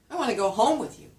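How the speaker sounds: quick, loud, "home" punched high; stress on "home". Shown as a demonstration. Answering where to go, stress on "home". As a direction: hit "home" hard. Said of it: "Where do you want to go".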